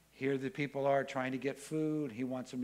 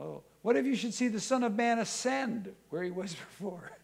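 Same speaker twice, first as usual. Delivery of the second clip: playful laughing challenge; 7 semitones higher, 4 dB louder than usual. Acted. Jesus delights in the conversation.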